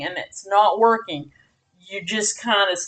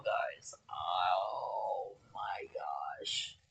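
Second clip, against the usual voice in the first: breathy aside; 20 dB quieter, much slower than usual. Real, not acted. Exhausted aside to viewers.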